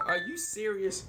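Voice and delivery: high, hushed disbelieving question; stunned at the accusation; disbelief. Retold or imitated. Incredulous at the officer calling him undocumented.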